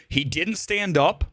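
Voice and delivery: normal talking voice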